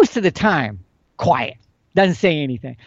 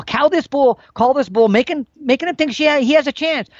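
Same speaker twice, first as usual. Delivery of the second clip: getting urgent; 8 semitones higher than usual. Retold or imitated. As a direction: push it like the moment has come. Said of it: "I'm gonna get real urgent".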